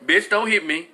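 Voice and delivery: ordinary talk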